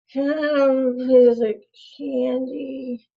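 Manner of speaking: put-on high, slow kid voice. Kid retries asking parent for candy, still whiny. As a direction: drag it out in a milder child's whine. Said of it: "you're getting closer".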